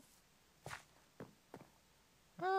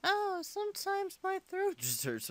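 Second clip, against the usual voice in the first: ordinary delivery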